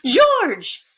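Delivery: high, loud gasping call; surprised cry. Acted. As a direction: gasp and call his name. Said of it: "said Missus Lambchop".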